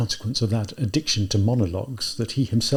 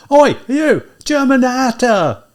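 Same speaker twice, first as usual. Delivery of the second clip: high, loud shout drawn out at the end; drunken bellow; 12 semitones higher, 9 dB louder, slower than usual. A narrator's reading. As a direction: yell it at the top of your voice. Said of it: "bellow at him at the top of his voice".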